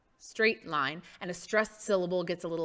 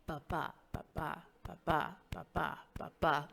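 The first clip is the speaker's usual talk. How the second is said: soft ba-BA pairs like a heartbeat; imitated heartbeat; 3 semitones lower, 8 dB quieter than usual. Shown as a demonstration. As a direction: quietly beat out a pulse in pairs. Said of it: "sounds like a heartbeat".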